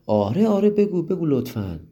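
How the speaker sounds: can't wait to hear the secret; happily eager. Acted. Child begging friend to tell the secret, excited. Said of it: "مریم با خوشحالی گفت".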